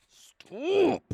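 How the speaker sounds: exasperated squeal. Real, not acted. Frustrated at the game.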